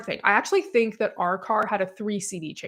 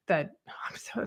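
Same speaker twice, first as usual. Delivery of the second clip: whispered; 4 semitones lower, 9 dB quieter than usual. Real, not acted. Searching for a word.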